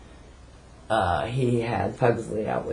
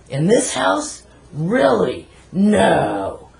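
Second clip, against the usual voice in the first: mocking disbelief; 7 semitones higher, 5 dB louder, much slower than usual. Retold brother's mocking reply.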